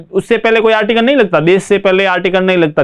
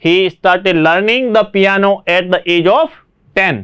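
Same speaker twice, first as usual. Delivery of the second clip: slow dictation; much slower than usual. Shown as a demonstration. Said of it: "reading the answer".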